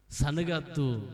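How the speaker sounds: normal talking voice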